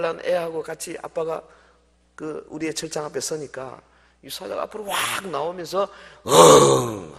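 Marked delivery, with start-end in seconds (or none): 6.3-7.2 short loud growl like a lion. Retold or imitated. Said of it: "하는 거예요". (사자 울음 흉내)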